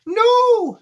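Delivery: high, loud cry of no; teacher reading Charlie's shout, loud and high. A narrator's reading. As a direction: yell it in alarm. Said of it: "shouted Charlie".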